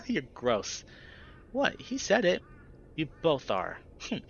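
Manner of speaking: put-on snooty childish. A narrator's reading. Heather scolding her gross friends, snooty childish. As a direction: look down on them like a silly kid.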